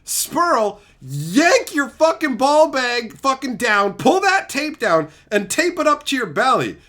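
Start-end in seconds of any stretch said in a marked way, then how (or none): none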